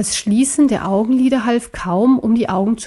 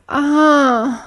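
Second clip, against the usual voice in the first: sharp pained groan, pitched up; loud groan; 5 semitones higher, 3 dB louder than usual. Acted. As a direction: cry out as bright light stings the eyes. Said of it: "stöhnte Sir Johann laut auf".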